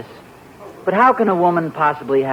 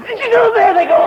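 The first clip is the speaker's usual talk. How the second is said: startled yelp; 5 dB louder than usual. Acted. Husband reacts to cold feet.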